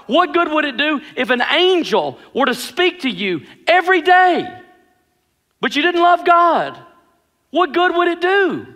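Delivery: high-pitched, driving sermon delivery; impassioned preaching. Real, not acted. Preacher urging the congregation to love God, impassioned preaching. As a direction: preach it with rising fervor, punching the key words.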